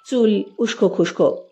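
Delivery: usual speaking voice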